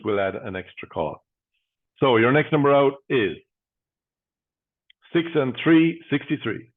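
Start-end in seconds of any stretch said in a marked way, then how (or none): 2.0-6.7 steady, rhythmic number calling; bingo caller. Acted. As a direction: call each number out, then pause.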